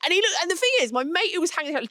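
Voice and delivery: usual speaking voice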